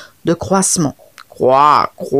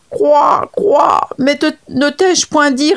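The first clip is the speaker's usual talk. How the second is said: higher, crying croaks; wailing frog; 7 semitones higher, slower than usual. Acted. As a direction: wail out the grief to stall him. Frog wails at the leopard. (grenouille qui se lamente en criant)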